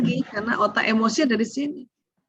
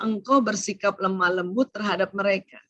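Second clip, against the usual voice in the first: usual speaking voice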